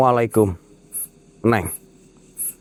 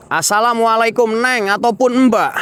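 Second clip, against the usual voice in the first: far higher and louder greeting to the ghost; calling out; 14 semitones higher, 4 dB louder than usual. Real, not acted. Ghost hunter hailing the ghost woman, high loud call. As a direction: cry it out up high.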